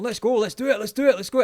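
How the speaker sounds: hyped-up American, high and loud; American accent. Retold or imitated. American stranger right in his face, imitated hyped American accent. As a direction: imitate an over-eager American pumping someone up. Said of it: "American as well".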